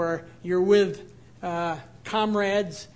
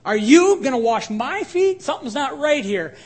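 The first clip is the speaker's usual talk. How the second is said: higher and louder, "you" stressed in disbelief; incredulous Peter; 5 semitones higher, 8 dB louder than usual. Retold or imitated. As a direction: blurt it out, shocked. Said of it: "Peter is always the one to say".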